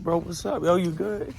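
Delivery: lifted, gently worried check-in; high, concerned. Retold or imitated. Asking the upset inmate.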